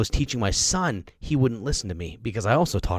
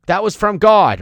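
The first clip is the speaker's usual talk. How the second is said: mock insistent parent; 11 semitones higher, 14 dB louder than usual. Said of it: "give five dollars to my kid and say".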